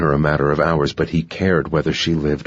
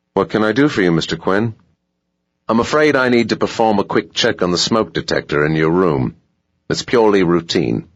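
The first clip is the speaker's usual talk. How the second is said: put-on polite hotel clerk in a light South African accent, a bit higher; smooth, dutiful South African manager at the door; a hint of South African, courteous and pitched up; 4 semitones higher, 3 dB louder than usual. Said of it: "South African accent".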